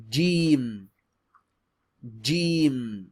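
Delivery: Jeem said loud, long ee, closing on m; teacher modelling Jeem; Arabic letter Jeem. Shown as a demonstration. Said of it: "last sound is like an M".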